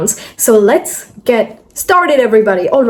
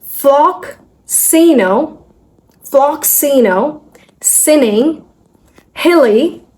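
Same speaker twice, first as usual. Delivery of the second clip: slow, piece by piece with pauses; broken into syllables; 3 semitones higher, much slower than usual. Shown as a demonstration. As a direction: chunk the long word slowly. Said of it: "we're just gonna break it".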